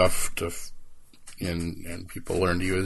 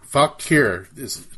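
normal talking voice

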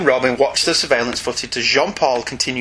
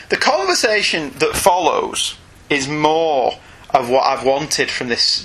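ordinary talk

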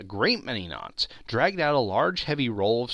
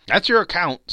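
a hint of a shrewd old dealer; lightly voiced old pawnbroker; old Joe; 7 semitones higher, 8 dB louder than usual. A narrator's reading. Old Joe tallying up the stolen goods, lightly voiced.

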